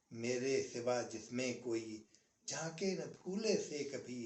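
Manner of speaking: slow, lingering nazm recital, every line weighed; poem recitation. Acted. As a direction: recite the old nazm with a pause after each line. (ठहर-ठहर कर नज़्म पढ़ना)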